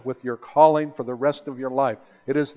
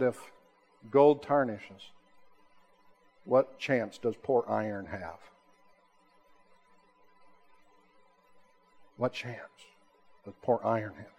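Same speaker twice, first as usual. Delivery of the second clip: solemn quoted warning; 6 semitones lower, 9 dB quieter than usual. Retold or imitated. Mentor to his student about gold and iron, solemn and hushed. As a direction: retell a mentor's sober words, softly and gravely.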